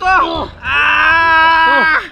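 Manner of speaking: high crying scream of pain; trapped friend screaming in distress; anguished scream. Acted. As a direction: let out a sobbing yell. Struggling in quicksand.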